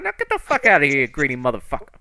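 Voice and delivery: acted furious dismissal; angry dismissal. Character orders man away.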